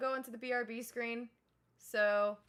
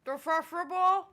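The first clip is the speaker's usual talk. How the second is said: talking through a mouthful of food; words round a big bite of stew; mouth full; 3 semitones higher, 6 dB louder than usual. Pretend. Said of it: "shoving food in my mouth".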